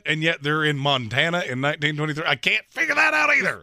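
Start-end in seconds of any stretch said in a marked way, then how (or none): 2.3-3.6 high-pitched burst of bafflement; exasperated. Real, not acted. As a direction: throw your hands up vocally, pitch leaping. Exasperated by accents in show.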